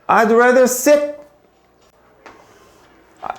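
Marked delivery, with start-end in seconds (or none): 0.1-1.1 very slow, higher, each word apart; slow model reading. Shown as a demonstration. Teacher reading the sample line slowly to the class. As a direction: read I'd rather sit slowly for learners. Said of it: "그림에서 보다시피".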